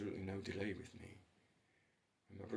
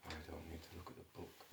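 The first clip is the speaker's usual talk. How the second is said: ordinary talk, nothing marked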